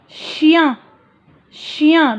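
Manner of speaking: ordinary talk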